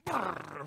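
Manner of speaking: trilled noise of a stampede; rolled brrr of rushing. Retold or imitated. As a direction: imitate a herd rushing off with a trill. Imitates pigs rushing downhill.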